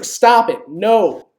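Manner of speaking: ordinary delivery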